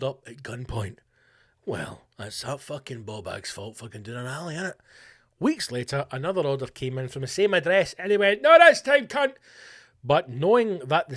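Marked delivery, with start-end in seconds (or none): 1.6-4.7 muttered aside. Real, not acted. 8.4-9.3 high, loud mock shout; mock defiant shout. Voicing the owner defiantly.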